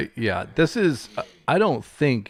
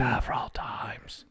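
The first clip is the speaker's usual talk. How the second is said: soft whisper; 10 dB quieter than usual. Real, not acted. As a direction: breathe it as an aside. Whispered aside.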